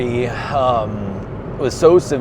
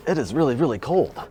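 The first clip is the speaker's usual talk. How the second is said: ordinary delivery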